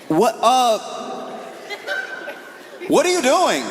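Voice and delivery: high, startled buddy asking what's up; baffled friend voice. Retold or imitated. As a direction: squeak out a confused what are you doing. Friend's baffled reply on phone.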